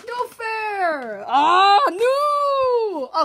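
high, drawn-out cry of dismay; wailing protest. Real, not acted. Player losing the game, loud drawn-out wail.

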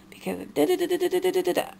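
high, fast mocking babble; mocking gibberish. Mocking mom's excuses as gibberish.

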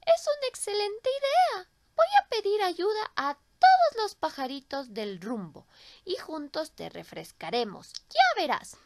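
a cheerful little bird offering help. Acted. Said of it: "dijo el pajarillo".

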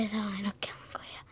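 fast unvoiced whisper. Real, not acted. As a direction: whisper the instructions. Whispered stage direction.

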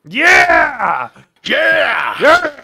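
very loud, high-pitched yells; over-the-top hype screaming; shouted yeah. Pretend. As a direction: belt out each yeah at full blast. Yelling a mock hype cheer.

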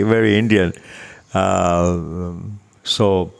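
ordinary delivery

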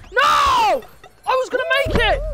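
high yelled protest; real gamer rage, loud and high; frustrated shout. Real, not acted. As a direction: yell it as you fall off. Falls off again, shouting.

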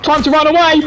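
excited shouting. Real, not acted. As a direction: shout it out, thrilled. Excited chase.

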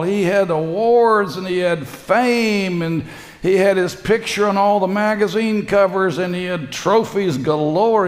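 high, loud, ringing preacher voice; preaching at full force. Acted. Preacher thundering to the congregation about empty fame. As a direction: drive the sermon home with fire.

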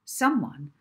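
ordinary delivery

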